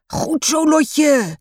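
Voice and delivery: grandpa's high, loud cheer; calling out. A narrator's reading. Grandpa cheering Lotje's goal at football, calling out. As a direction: call out high to cheer her on. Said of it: "roept opa".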